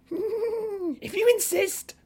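high, slow cackling voice; eerie hyena voice. A narrator's reading. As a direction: drawl it like a giggling hyena. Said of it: "laughing eerily".

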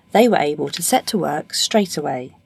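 plain reading voice

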